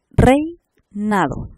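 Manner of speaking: REINADO very slow and loud, EI in one glide; diphthong example. Shown as a demonstration. Teacher repeats an example for students, REINADO slow and emphatic. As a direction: draw out REINADO, stressing the joined EI. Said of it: "vocal abierta".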